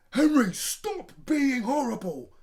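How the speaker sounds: high, loud angry yell; yelling father. A narrator's reading. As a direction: bark it at the naughty boy. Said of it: "yelled Dad".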